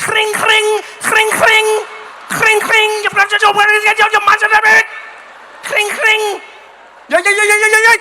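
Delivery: loud high frantic ring ring; angry bell imitation. Comic imitating an angry Dutch bike bell, shouting. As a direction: ring the bell like it's furious. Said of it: "I've never heard a bell sound angry".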